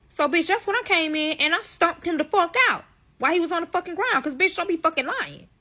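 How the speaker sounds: ranting as she relives stomping him; heated. Real, not acted. Retelling stomping the lying friend.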